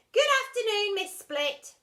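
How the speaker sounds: high, loud bright greeting; chirped. Acted. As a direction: chirp it like a cheeky schoolgirl. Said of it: "chirped the girl".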